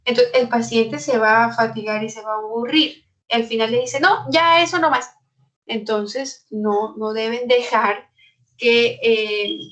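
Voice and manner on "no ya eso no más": higher, louder, exasperated; a patient who has had enough, cutting it short; fed-up patient. Acted. Worn-out patient snaps back at the doctor who keeps interrupting, exasperated.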